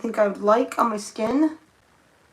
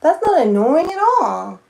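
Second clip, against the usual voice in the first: sarcastic; 6 semitones higher, 7 dB louder than usual. Real, not acted. Sarcastic follow-up on the brush.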